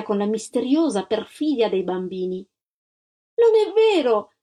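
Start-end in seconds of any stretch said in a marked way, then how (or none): 3.3-4.3 high, loud protest; indignant cry. A narrator's reading. Antonella to the lady, crying out in protest. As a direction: cry out against the lie. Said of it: "gridò Antonella".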